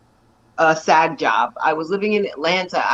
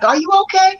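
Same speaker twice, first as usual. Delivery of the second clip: neighbors' concern; 13 semitones higher, 4 dB louder than usual. Retold or imitated. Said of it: "everybody's like".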